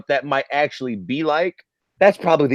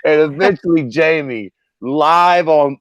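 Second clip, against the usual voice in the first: ordinary talk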